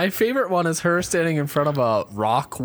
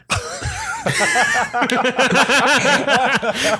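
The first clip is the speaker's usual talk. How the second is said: loud, high helpless laugh; burst of laughter; 10 semitones higher, 4 dB louder than usual. Real, not acted. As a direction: crack up loudly. Reaction to the death news.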